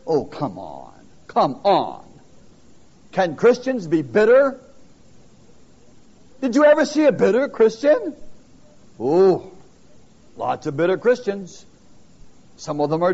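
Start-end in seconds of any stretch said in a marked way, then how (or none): none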